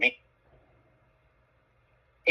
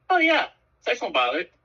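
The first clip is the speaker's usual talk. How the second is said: doctor's voice; 5 semitones higher than usual. Retold or imitated. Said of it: "He goes".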